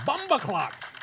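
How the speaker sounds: high-pitched, playful outburst; exclaimed catchphrase. Pretend. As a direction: cry it out as a catchphrase.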